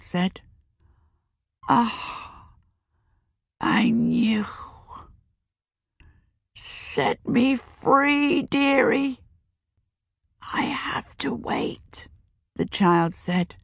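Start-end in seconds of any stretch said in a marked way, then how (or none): 1.7-9.2 high, slow, fading crone plea; old witch begging to be set free; dying witch. A narrator's reading. Dying witch asks release. 10.4-12.1 slow, soft unvoiced whisper; child whispering beside the cot. A narrator's reading.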